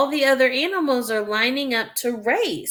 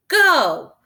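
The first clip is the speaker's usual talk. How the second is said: start call; 7 semitones higher, 5 dB louder than usual. Acted. Calling start of race.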